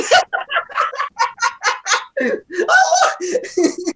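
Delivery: high, helpless laughing that tips into a cry; crying laughter. Real, not acted. Telling the Villa joke, crying with laughter. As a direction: laugh until it squeaks into a shriek. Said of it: "that shit's hilarious".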